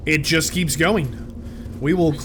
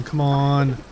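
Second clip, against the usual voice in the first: ordinary talk, nothing marked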